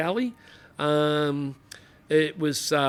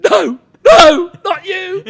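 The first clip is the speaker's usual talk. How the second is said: high, loud horrified no; shocked disbelief; 13 dB louder, much slower than usual. Retold or imitated. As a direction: recoil in disbelief at him. Sergeant sees old hoodlum recruit.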